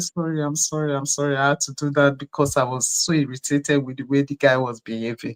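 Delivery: low, soft retold apology; quiet, low explaining to the children; subdued apology. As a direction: apologize sheepishly under your voice.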